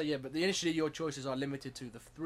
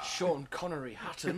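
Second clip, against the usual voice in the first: rubbish mock Scottish Connery voice; a bad Sean Connery impression, half laughing; Sean Connery accent; 3 semitones higher, 4 dB louder than usual. Host introducing himself in a rubbish Sean Connery accent. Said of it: "that is a rubbish Sean Connery accent".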